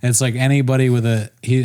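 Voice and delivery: ordinary talk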